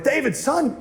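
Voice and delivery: high, hammed-up lecture; staged scolding. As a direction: put on a stern show for the crowd. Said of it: "I'm doing this kinda for the parents".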